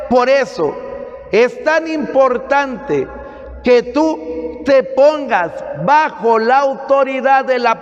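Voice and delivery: emphatic sermon in long, insistent phrases; preaching. Acted. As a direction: drive it home to the congregation. Preaches to a congregation.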